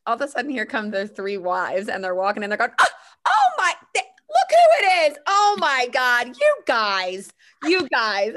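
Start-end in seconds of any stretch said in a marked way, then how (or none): 2.8-8.3 very high, loud excited shriek; squealing delight. Retold or imitated. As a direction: squeal like surprised friends spotting each other. Wives greeting the husbands, squealing.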